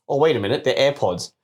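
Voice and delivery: ordinary delivery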